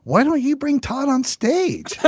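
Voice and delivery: high, slow lady voice; mock sweet organizer. Organizer asks the neighbor to introduce him, high and slow.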